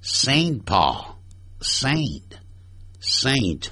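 Saint said slowly with a long A, a quiet plea; softly drawn-out Saint Paul, please get it right; correct Saint Paul. Shown as a demonstration. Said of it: "拜托". (无奈地慢读Saint Paul)

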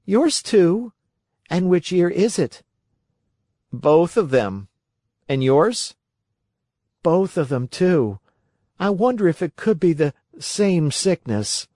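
put-on high, lively boy character voice; boy puppet voice. A narrator's reading. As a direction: voice the young puppet.

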